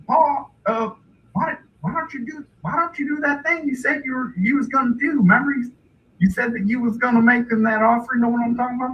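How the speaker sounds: high, fast, halting son; hillbilly son. Retold or imitated. Son reminds Pa of their offer, hillbilly nudging. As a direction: nudge Pa in a high rube voice. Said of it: "and then his son's like".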